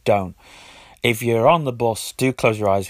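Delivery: normal talking voice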